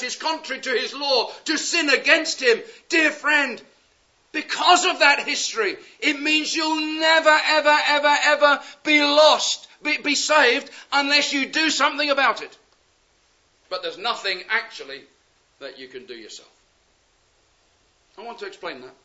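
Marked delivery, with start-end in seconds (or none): 2.9-12.6 higher and louder, hammering each ever; fervent preacher warning his hearers; full-force preaching. Real, not acted. 13.7-18.9 suddenly much softer and lower; preacher goes hushed to make them lean in. Real, not acted. Sudden drop after the preaching peak.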